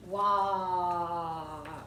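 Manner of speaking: WALKS with a very long held vowel; slow sounding out. Shown as a demonstration. Teacher hunting for the next sound, slowly stretched WALKS. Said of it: "I'm gonna say it slowly".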